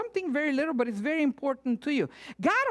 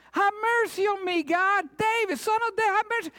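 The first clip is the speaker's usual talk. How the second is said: crying out from afar; 8 semitones higher, faster than usual. Acted. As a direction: call out desperately from a distance. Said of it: "this lady is like".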